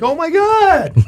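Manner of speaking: high startled gasp; shocked. Retold or imitated. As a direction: blurt it out in fright. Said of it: "And you're like".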